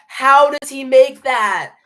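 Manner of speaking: loud, high, stretched disbelief; exasperated outcry. Real, not acted. Fan reacting to the rival's tough shot, exasperated outcry. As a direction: cry it out slowly in disbelief.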